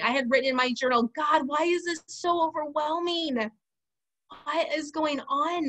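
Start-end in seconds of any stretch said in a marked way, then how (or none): 1.2-5.7 drawn-out, raised-pitch plea; overwhelmed plea. Retold or imitated. Reading own journal plea to God, overwhelmed. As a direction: plead upward, stretching the words out.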